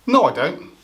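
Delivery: usual speaking voice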